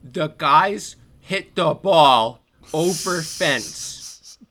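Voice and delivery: loud, very slow, talking down; mock condescending. Boyfriend explaining baseball to her, loud and slow, patronizing. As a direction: spell it out as to a child. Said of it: "how he explained it to her".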